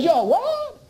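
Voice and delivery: high, incredulous question; incredulous boss. Retold or imitated. The director hears he is a volunteer, incredulous. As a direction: hit WHAT in disbelief.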